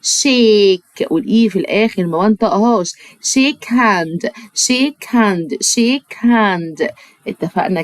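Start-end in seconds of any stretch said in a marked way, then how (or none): 0.0-1.1 شيك held long, sh sound, silent e; drawn-out shake. Shown as a demonstration. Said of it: "الاس اتش انطقها شا". 3.2-6.9 شيك هاند said slowly, stress on شيك; slow repetition. Shown as a demonstration. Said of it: "انطقها شا".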